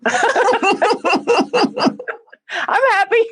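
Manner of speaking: squeaky high, laughing and teary; laugh-crying happy. Real, not acted. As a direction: say it through happy tears, very high. Tearful joke about being happy.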